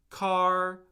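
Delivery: "car" held long on one high, even pitch; high level tone. Shown as a demonstration. Teacher shows tone won't change an English word, high level tone. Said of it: "by changing the tone".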